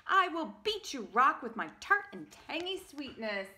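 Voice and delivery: loud, high boasting fruit voice; cocky apricot warrior; boastful apricot. Acted. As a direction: brag about your sweetness.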